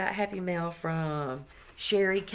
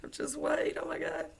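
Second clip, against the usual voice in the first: delighted squeal; 9 semitones higher, 4 dB quieter than usual. Real, not acted. Opening happy mail, delighted.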